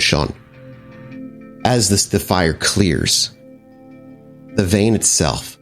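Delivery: usual speaking voice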